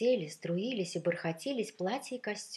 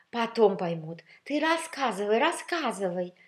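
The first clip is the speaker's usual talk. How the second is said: high, loud, eager prodding; insistent, impatient urging; merciless curiosity; 5 semitones higher, 8 dB louder than usual. A narrator's reading. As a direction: hurry the storyteller along, hungry for gossip. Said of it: "с беспощадным любопытством торопили шляпки".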